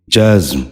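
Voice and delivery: جزم said "jazm", no vowel between z and m; z held straight into the m of جزم; correct pronunciation. Shown as a demonstration. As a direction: say جزم as one syllable, "jazm". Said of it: "آپ بھی غور سے سنیے".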